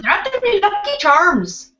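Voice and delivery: high, hammed-up Irish accent quoting a cereal ad; mock Irish accent. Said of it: "I can really do it".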